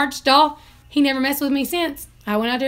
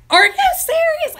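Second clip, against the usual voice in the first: incredulous; 6 dB louder than usual. Retold or imitated. Reacting to rooster attacking shoe.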